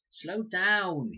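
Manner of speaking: put-on high, loud childish wail; boy dragged along, wailing at his sister. A narrator's reading. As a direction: wail it like a tired little boy. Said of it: "he wailed".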